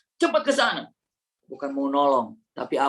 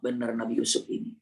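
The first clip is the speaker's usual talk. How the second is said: usual speaking voice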